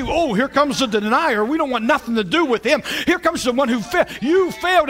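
sneering mock taunt, pitch climbing to a jeer; hammed-up disciples' sneer; mock scorn. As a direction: taunt the fallen one.

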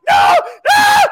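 high, loud shouted denial; terrified shout. Acted. The hero refusing his lover's words, a terrified high shout. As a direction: yell no in terror. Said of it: "because he doesn't wanna die".

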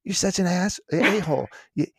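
a mock inner critic telling off the speaker; scornful inner voice. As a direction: berate yourself in your own head, mock disgust. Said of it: "the immediate thought that goes through my head".

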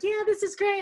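high, loud mock cheer; retold excitement. Coach recalling the loud opening ceremonies, excited high shout. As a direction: whoop it up as if over pounding music.

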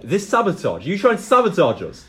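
angry fighter accusing the thief. Acted. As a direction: accuse them, rising in anger. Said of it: "this guy's like".

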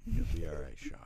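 low, whispery stoner reassurance; unexcited stoner babysitter. Retold or imitated. Stoner babysitter to a bleeding child, low unbothered mock reassurance. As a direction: brush it off in a low half-whisper. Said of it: "she didn't get very excited at all".

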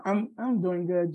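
ordinary talk, nothing marked

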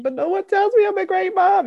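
high, loud, almost squealing protest. Retold or imitated. To her husband, a high indignant protest. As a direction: push the pitch way up in disbelief. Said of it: "I was like".

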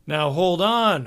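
the manager pushes back at his upset band, defensive. A narrator's reading. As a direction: hold them off, a touch defensive. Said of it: "Hogarth said defensively".

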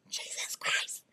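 hushed, voiceless exclamation; despairing whisper. Retold or imitated. Hearing bad news on the phone, despairing whisper. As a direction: whisper it in acted dismay.

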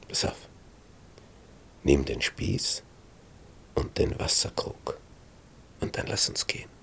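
hushed whisper. A narrator's reading. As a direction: sneak the words out, barely voiced. Sneaking out of enemy camp.

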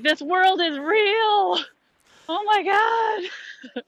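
high, slow awestruck cry; amazed. Retold or imitated. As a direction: gasp it out in wonder. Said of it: "It was like".

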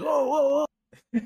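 high-pitched mock "oh, oh, oh"; imitated stabbing cries. Friend retelling a fight scene where he gets stabbed, imitated yelps. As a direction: mimic him yelping as the stabs land. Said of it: "and he was like".

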